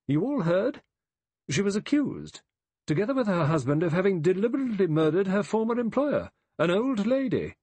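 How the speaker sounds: high, loud, hard accuser; tight, hard-lipped. A narrator's reading. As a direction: accuse through tight lips, pitched right up. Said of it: "her lips tight and hard".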